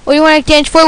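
ordinary delivery

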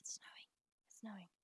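hushed contented murmur; quiet repeat. Real, not acted. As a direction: say it under your breath, contentedly. Repeats snowing softly to herself.

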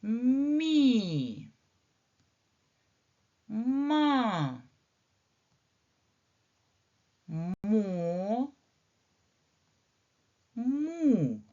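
each syllable sung out long and high; teacher modelling syllables; drawn-out syllables. Shown as a demonstration. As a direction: read the syllables slowly for children. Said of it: "Давайте разом прочитаємо".

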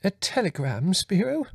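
high, quavering question rising sharply; an anxious mother's quaver. A narrator's reading. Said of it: "Mother quavered".

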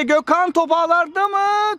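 high rising goal question; excited commentator. Real, not acted. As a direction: lift it high with suspense. Live goal call.

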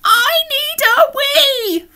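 high, loud, drawn-out squeal; desperate wail. Acted. Alan facing a long toilet queue, desperate wail. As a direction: wail it slowly at high pitch.

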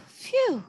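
high, falling "phew" after a sigh; relieved phew. A narrator's reading. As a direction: let out a long breath of relief. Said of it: "letting out a long breath of relief".

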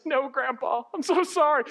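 high, hammed-up boyish apology; pleading apology. Grandson to scolding grandpa, mock pleading. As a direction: play a scolded grandson begging pardon.

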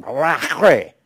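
"Rachre" with harsh, throat-scraping CH; hammed-up spitty guttural on "Rachre"; exaggerated guttural Hebrew. Shown as a demonstration. Teacher mocking correct Hebrew pronunciation, exaggerated guttural. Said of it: "They had those guttural sounds".

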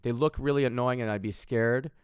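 ordinary talk

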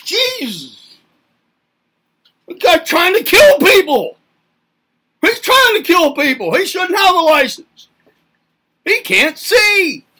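loud, high outraged yelling at a reckless driver; acted road rage. Driver yelling at a speeding car, loud outraged shout. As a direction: bellow at the car that cut you off.

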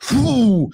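high-pitched whoop, acted out; retold "whoo" of relief. Relief after retold standoff.

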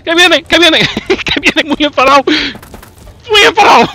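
high, loud half-laughing panic; panicked shouting. Pretend. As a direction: shriek as the angry monster charges. Monster charges, panicked shouting. (gritos agudos de pánico entre risas)

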